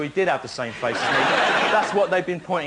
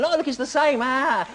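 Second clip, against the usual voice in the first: high excited mimicry of strangers; hammed-up giddy delight; mock excited squeal; 9 semitones higher than usual. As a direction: squeal like a thrilled fan. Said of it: "waving and going".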